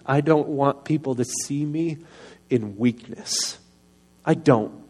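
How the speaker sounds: ordinary talk, nothing marked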